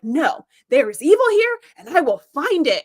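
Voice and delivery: mock-dramatic vow to root out evil; zealous declaration. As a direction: imitate a crusading official laying down the law. Voicing zealous admiral's declaration.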